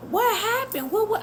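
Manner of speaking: high-pitched, alarmed retelling of her own words; alarmed question. Retold or imitated. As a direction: squeak it out, caught off guard.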